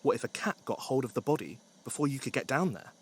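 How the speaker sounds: ordinary delivery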